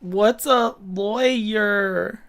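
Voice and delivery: put-on high, drawn-out child voice; puzzled small kid, words stretched out; little boy. Game master as lost boy asking about lawyers, little-kid voice. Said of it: "like, an eight-year-old boy".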